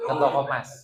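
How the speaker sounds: punchline said through a laugh; laughing repeat. Acted. Preacher repeating the gold-shop joke to the crowd, laughing.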